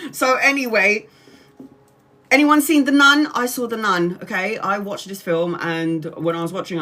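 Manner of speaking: ordinary talk, nothing marked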